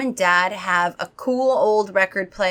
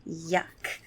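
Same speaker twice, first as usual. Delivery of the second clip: plain reading voice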